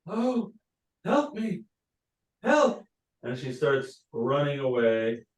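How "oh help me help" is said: high, frantic cry for help, rising to the last help; the queen cries out in fright, pitched well up; frightened yell for help. Acted. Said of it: "she yells out".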